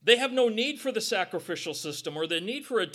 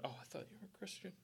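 fast, much softer, almost under breath; quick hushed mock quote; muttered aside; 19 dB quieter, much faster than usual. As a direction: say it fast and quietly to yourself. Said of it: "they don't go".

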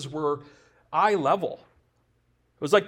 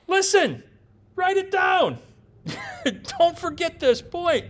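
emphatic shout; 11 semitones higher than usual. Real, not acted. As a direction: shout it so they write it down. Preacher urging congregation to remember.

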